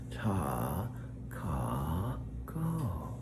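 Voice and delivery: Ta ka ko sung out syllable by syllable, pitch swooping; syllable coaching. Shown as a demonstration. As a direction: teach Takako as three slow syllables. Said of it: "I'm Alexa's voice coach".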